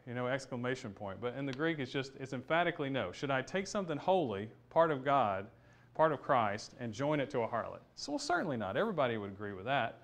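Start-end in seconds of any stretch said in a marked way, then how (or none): none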